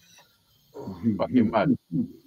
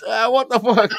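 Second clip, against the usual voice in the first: higher-pitched mock alarm; imitating startled teacher; 6 semitones higher, 4 dB louder than usual. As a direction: act out the teacher caught off guard. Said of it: "now the teacher's like this".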